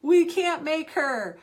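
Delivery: slow, high, loud exasperation; drawn-out, exasperated retort; exasperated reply. Retold or imitated. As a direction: spell it out slowly, fed up and loud. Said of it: "I'm like".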